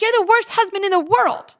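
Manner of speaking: high, sharp mock jab at a spouse; imitating a heated jab at her husband; mock angry insult. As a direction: toss it like a spiteful wife.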